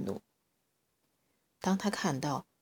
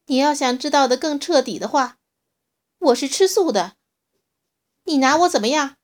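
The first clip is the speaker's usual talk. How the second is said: loud, higher defiant challenge; 8 semitones higher, 11 dB louder than usual. A narrator's reading. Little dinosaur defiantly challenging the T-rex, loud. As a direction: stand up to the bully.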